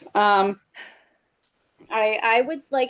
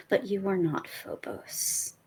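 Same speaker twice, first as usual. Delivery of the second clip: menacing whisper; 3 semitones lower, 7 dB quieter, much slower than usual. Acted. Menacing recorded threat.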